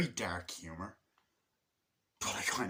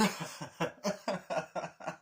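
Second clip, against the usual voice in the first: high, staccato put-on giggling; imitated ticklish laugh; 6 semitones higher than usual. Girlfriend being tickled in retelling, mock giggling. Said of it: "tickling her, and she goes".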